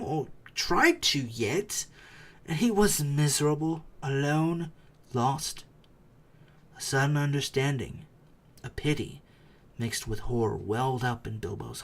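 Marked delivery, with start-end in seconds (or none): none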